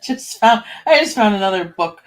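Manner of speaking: giggling at a surprise find; amused laughing. Real, not acted. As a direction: laugh as you start to say it. Amused by finding another book.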